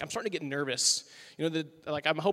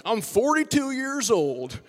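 put-on very slow, stretched-out old-soul drawl; imitating a slow-talking guy revealing his age; molasses drawl; 3 semitones higher, 4 dB louder, much slower than usual. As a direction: let each word roll out slowly. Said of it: "like molasses rolling out of his mouth".

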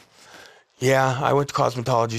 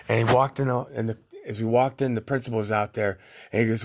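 ordinary talk

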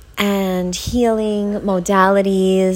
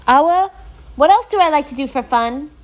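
higher calling voice to someone in the next room; 9 semitones higher than usual. Real, not acted. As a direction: call across the flat to Awa. Calling to Awa in kitchen.

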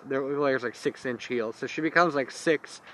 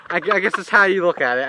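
laughing; 5 semitones higher, 6 dB louder, faster than usual. Real, not acted. Still laughing, fast.

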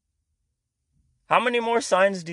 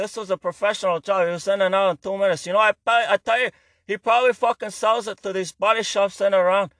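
loud, higher, swaggering wiseguy rant; cursing tough guy; 5 semitones higher, 3 dB louder than usual. Retold or imitated. To the appraiser, a loud cursing gangster rant. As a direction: rant like a foul-mouthed gangster at the desk. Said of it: "For some reason I was talking like that".

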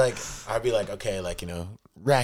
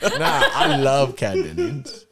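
laughing; 3 semitones higher, 5 dB louder, much slower than usual. Real, not acted. Swooning over actress.

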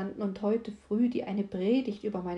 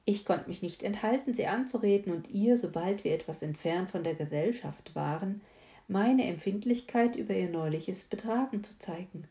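plain reading voice

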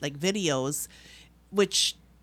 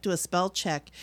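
ordinary delivery

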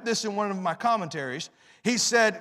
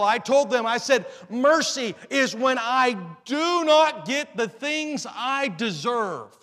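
forceful preaching; 5 semitones higher, 6 dB louder than usual. Real, not acted. Preacher telling congregation what he deserves, forceful preaching. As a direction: drive each point home from the pulpit.

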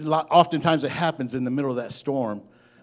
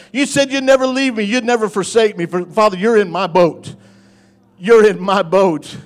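loud, raised declaration of faith; impassioned preaching prayer; 8 semitones higher, 11 dB louder than usual. Real, not acted. Preacher declares to Jesus his faith, impassioned preaching prayer. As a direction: cry out trust to God. Preaches and prays at full force.

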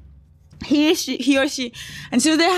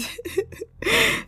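a high, soft giggle breaking out; 11 semitones higher, 5 dB quieter than usual. Real, not acted. Retelling the hacked excuse to listeners, high soft giggle. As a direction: let a squeaky giggle slip. Laughing at the story.

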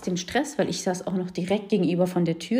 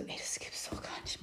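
hushed, breathy disbelief to herself; whispered inner thought; 15 dB quieter than usual. Retold or imitated. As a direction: whisper it like a thought you can't say aloud. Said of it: "Und ich dachte mir so".

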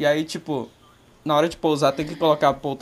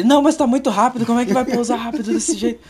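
higher, louder fretful dad voice; imitating a frightened father, pitched up; panicky dad; 5 semitones higher, 4 dB louder than usual. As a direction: mimic a nervous father sure the plane is too fast. Dad panicking about fast landing.

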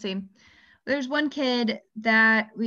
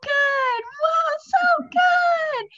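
much higher, louder, sing-song cheering; over-the-top praise; 4 dB louder, slower than usual. Retold or imitated. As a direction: gush like a cheerleader for a toddler. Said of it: "And I go".

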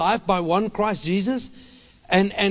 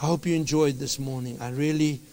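hushed, gentle closing address; soft, settled pastoral voice; calm, soft sermon close; 8 semitones lower, 7 dB quieter than usual. Real, not acted. Soft, calm closing after loud preaching.

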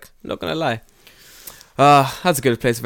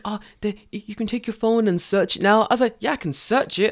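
much higher back-and-forth; mock raised voices; 10 semitones higher than usual. Arguing with the NHK man, raised mock voice. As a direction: play it up high. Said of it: "He's like".

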